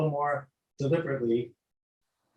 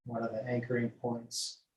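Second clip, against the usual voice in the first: guided meditation. Acted. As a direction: lull them gently from toes upward. Leads body scan meditation.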